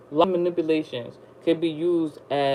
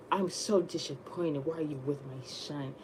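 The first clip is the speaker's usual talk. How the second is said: disappointed mother; 2 semitones lower, 11 dB quieter than usual. Retold or imitated. Voicing the boyfriend's mother again.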